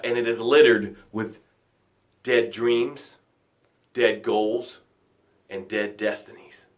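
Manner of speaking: low and soft, with heavy pauses; grim, sombre. Real, not acted. Sinking to ocean floor of doubt.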